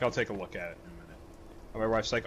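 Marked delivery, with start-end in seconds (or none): 0.0-1.2 offhand mumble. Retold or imitated. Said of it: "And I'm like".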